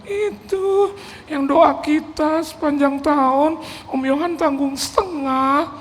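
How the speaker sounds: high, slow mock-weeping voice; imitated tearful wife. Wife explains the answered prayer, imitated tearful voice. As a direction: draw each phrase out high and weepy.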